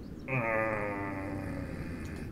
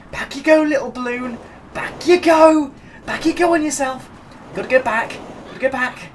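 coaxing singsong; 10 semitones higher than usual. Real, not acted. Coaxing the balloon back.

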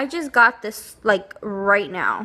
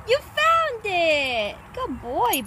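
very high, slow, gushing praise; shower the dog with joy; delighted praise; 9 semitones higher, slower than usual. Real, not acted. Owner praising her dog for finding the treat, very high delighted voice.

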